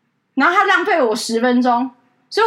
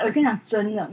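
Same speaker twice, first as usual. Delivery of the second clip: ordinary talk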